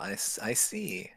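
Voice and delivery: breathy whispered aside. Acted. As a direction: whisper it under your breath. Reacts to a fake name.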